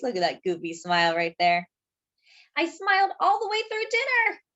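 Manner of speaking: ordinary delivery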